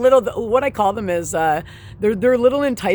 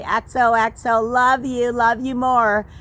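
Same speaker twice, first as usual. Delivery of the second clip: 1 mock sweet card; 5 semitones higher, slower than usual. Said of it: "it will say".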